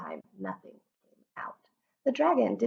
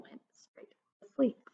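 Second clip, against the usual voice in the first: whispered narration; 5 semitones higher, 6 dB quieter than usual. A narrator's reading. Dragon falls asleep.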